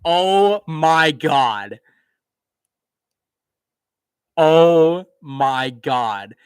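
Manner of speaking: loud, slow, drawn-out "Oh my God"; stunned disbelief. Real, not acted. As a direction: let the disbelief stretch out loud. Stunned by the game result.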